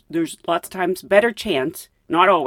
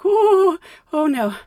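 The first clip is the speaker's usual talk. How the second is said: high drawn "oh" sinking into worry; comic wail that settles down; mounting dismay; 10 semitones higher, 3 dB louder, slower than usual. Retold or imitated. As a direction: moan it in mock dread. Retold dread, needing bathroom.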